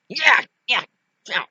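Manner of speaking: high, strained yelps; short high cries thrown out mid-fight; fight noises. Acted. Doll swinging at Chucky in a fight.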